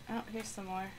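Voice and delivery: usual speaking voice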